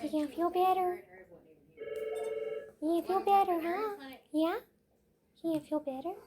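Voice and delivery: high, cooing pet voice. Real, not acted. Baby talk to sick dog.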